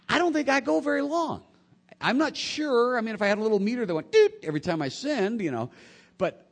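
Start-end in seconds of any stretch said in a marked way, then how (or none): 0.1-5.4 animated admission. Real, not acted. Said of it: "confessing own sinfulness".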